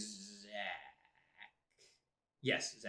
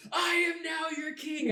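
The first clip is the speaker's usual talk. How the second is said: high, slow triumphant shout, laughing through it; shouting in Goblin; 5 dB louder, slower than usual. Retold or imitated. Player retelling his ranger's victory cry to friends, laughing shout. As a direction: roar it out as the new goblin king. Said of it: "just shouts in Goblin".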